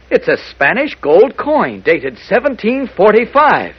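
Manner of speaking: slow, high-pitched amazement; astonished discovery. Acted. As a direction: announce an incredible find, drawing out each phrase. Astonished at pirate coin find.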